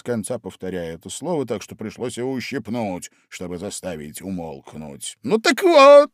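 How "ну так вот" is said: high, loud, excited Hatter; a lively know-all Hatter; loud, high, bustling Hatter. A narrator's reading. Hatter keeps telling.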